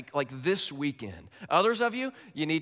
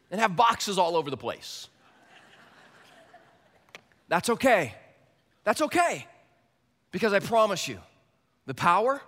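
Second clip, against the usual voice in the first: high loud reassurance; full-force preaching; 8 semitones higher, 7 dB louder than usual. Real, not acted. Pastor reassuring nervous sharers, loud preaching. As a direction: promise them with big conviction.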